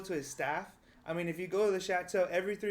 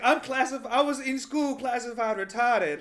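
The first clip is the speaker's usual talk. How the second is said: higher, louder, half laughing; heated insistence; 6 semitones higher, 8 dB louder than usual. Real, not acted. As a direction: hammer the point home loudly.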